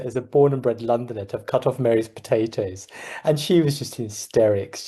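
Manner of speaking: normal talking voice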